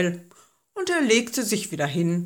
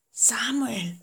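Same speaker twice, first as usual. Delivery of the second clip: breathy whisper of the name; whispered call; 4 semitones higher than usual. A narrator's reading. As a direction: whisper it from the dark. The voice calls a third time.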